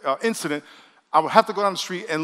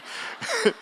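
laugh; 3 dB quieter than usual. Real, not acted. Pastor laughing at own story.

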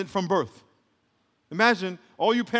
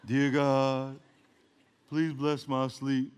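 low, slow, droning prayer; mock boring prayer; 6 semitones lower, 4 dB quieter, much slower than usual. Believer praying over food to a bored God, droning. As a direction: drone it softly, mocking rote grace. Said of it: "very bored with our prayers".

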